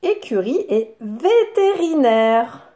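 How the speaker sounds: "Écurie et vétérinaire" with each syllable stressed. Shown as a demonstration. Teacher having children find ri, "Écurie et vétérinaire" syllabified. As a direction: beat out "Écurie et vétérinaire" evenly. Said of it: "la syllabe riz".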